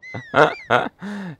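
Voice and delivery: real laughing fit; loud burst of laughter. Real, not acted. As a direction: explode into laughter. Teammate falls, streamer laughs.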